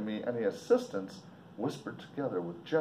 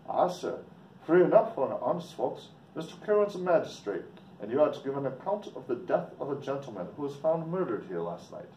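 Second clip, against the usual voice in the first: put-on Irish accent, a bit higher; Irish townsman, lightly voiced; 4 semitones higher than usual. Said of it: "Sorry about my English or Irish accent".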